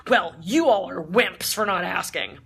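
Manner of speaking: loud, slow taunt to the cabin; mock-scold the whole plane; scornful jab. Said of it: "addresses the entire front of the plane".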